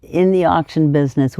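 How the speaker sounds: usual speaking voice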